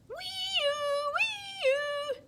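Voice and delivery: high, steady siren call; siren wail. A narrator's reading. Siren imitation.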